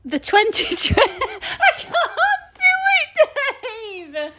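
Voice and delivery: giggling too much to get the words out; corpsing on the intro line; laughing. Real, not acted. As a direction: break up laughing mid-line. Wife giggling through the read.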